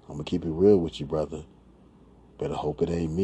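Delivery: ordinary talk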